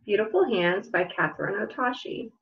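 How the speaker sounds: slow, gentle picture-book read-aloud with long pauses; reading to children. Acted. Librarian reading a picture book to children at home, slow storytelling voice. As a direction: let each question hang for little listeners.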